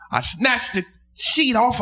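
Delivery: usual speaking voice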